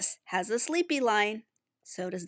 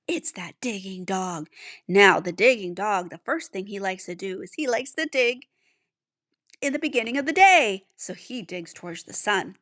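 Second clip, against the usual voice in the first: ordinary delivery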